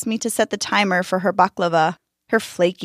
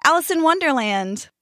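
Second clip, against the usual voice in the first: eager; 8 semitones higher than usual. Acted. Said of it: "A young girl eagerly raised her hand".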